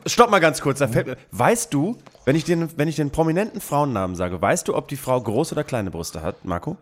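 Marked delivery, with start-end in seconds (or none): 0.1-0.8 cutting in. Real, not acted. Unterbricht laut die Runde.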